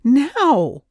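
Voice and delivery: loud, high, abrupt command; demanding. A narrator's reading. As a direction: demand it at once, loudly. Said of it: "she demanded".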